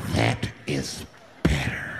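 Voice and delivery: very low, drawn-out words; slow way down and drop deep, mock menace; low menacing approval. Flasher to the hushed bus, low slow menace.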